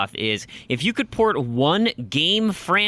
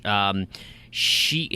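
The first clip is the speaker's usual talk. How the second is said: ordinary talk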